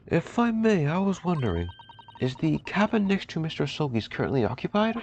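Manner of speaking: high, sweet, over-courteous voice; butter him up in a high polite voice; a high, fawning gentleman's voice; overly polite young man. Acted. Voicing the polite protagonist.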